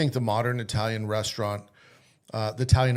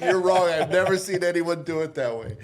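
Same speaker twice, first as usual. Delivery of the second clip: mock cocky insistence; 4 semitones higher than usual. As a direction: insist like a know-it-all kid. Young cook arguing, retold.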